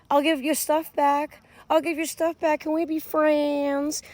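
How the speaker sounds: high, put-on plea, slowing at the end; mock pleading. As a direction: imitate someone bargaining for friendship. Voicing the pleading other party.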